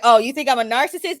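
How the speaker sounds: imitating a smug boyfriend who loves the label; flattered smugness. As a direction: take the insult as praise, brightly and fast. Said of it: "like it was a compliment".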